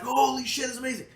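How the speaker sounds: high excited gasp of amazement; amazed. Retold or imitated. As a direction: squeal it out in disbelief. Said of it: "it was like".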